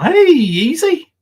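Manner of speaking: high, loud, slow sing-song taunt; mocking call-out. Calling out to Easy watching the stream, mocking sing-song. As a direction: drag out the greeting to needle him.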